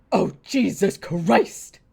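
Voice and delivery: loud, drawn-out exasperated curse; exasperated Frank Gallagher. Retold or imitated. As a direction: drag it out loudly, imitating a TV dad's crash out. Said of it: "And he just goes".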